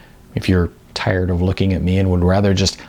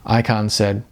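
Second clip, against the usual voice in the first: usual speaking voice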